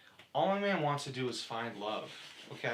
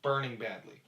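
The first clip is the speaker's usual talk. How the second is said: usual speaking voice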